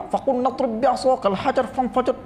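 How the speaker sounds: mock Indian-accented Quran reading; Indian-style recitation. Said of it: "lain macam pula cara dia baca".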